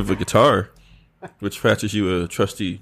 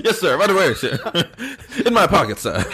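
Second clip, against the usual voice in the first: higher, laughing eager servant; eager squire; 10 semitones higher than usual. Pretend. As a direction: hand it over with a giggle.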